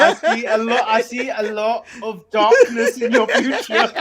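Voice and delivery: loud, high hammy seer; fortune teller voice. Pretend. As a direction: proclaim the dark future. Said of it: "Let me be a palm reader".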